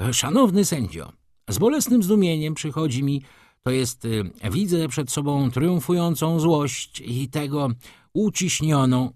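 high, orotund, faltering oration; speech in court. A narrator's reading. Rzecki addressing the judge, pompous halting speech. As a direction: orate grandly, then lose the thread. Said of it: "pora wypowiedzieć moją mowę".

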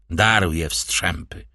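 ordinary delivery, nothing marked

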